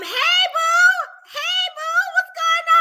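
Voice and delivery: high, loud sing-out hello; excited greeting. Real, not acted. Vlogger spots a friend joining mid-story, excited greeting. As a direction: call out to a friend in a high, drawn-out voice.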